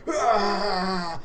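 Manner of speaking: high, loud groan of effort; straining cry. Acted. Two wrestlers locked in headlocks, acted straining yell. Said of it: "just straining".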